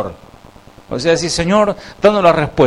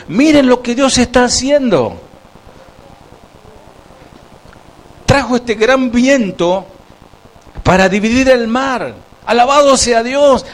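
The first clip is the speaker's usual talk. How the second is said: high, joyful call to praise; rally the people to worship, high and ringing; excited shout; 10 semitones higher than usual. Retold or imitated. Preacher voicing Israel's joyful cry to worship, high. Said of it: "capaz que gritó".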